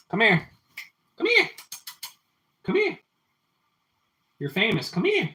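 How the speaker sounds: very high sing-song coaxing of a cat; pet talk. Real, not acted. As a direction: call the cat over in a squeaky high pet voice. Calling his cat Louie over.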